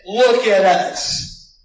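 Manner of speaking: slow, loud command of authority; commanding look at us. Acted. Apostle commanding the beggar, slow and loud. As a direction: make him look with authority.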